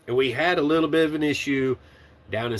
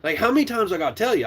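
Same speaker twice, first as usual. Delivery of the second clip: fast, higher, fed up; exasperated; 6 semitones higher, faster than usual. Retold or imitated. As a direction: rattle it off, fed up. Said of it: "I'm starting to lose my patience".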